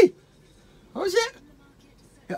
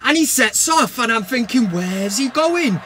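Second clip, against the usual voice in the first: higher and louder, a gleeful rant; excited rant; 5 semitones higher, 3 dB louder than usual. Acted. Host listing Fellaini's blunders to fans, gleeful and loud. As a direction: pile on the blunders with mounting glee.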